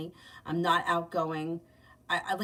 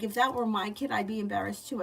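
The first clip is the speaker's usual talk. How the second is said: ordinary talk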